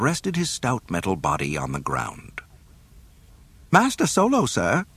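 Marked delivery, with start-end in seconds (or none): 3.7-4.8 high fussy droid calling out; prim robot calling up; C-3PO. A narrator's reading. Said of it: "three P O called".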